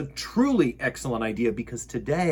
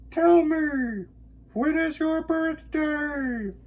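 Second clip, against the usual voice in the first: echo; slower than usual. Acted. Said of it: "his own voice echoed off".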